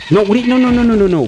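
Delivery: high, loud panicked protest. Real, not acted. Player yelling at his horse in a panic. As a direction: stop the horse in a rising panic.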